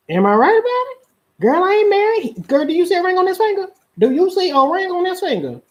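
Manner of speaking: high, insistent mock girlfriend voice; imitated girlfriend. Girl insisting to friends she ain't married, high mock protest. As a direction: protest in a high girly pitch. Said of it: "Ain't that what y'all say?".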